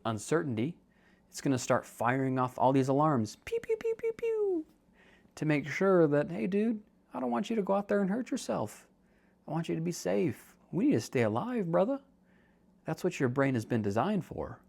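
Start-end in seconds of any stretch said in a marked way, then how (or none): 3.4-4.6 very high mock pew-pew alarms; alarm noises. Brain firing off alarms. 6.3-12.0 higher playful bro voice for the brain; brain as a buddy. Pretend.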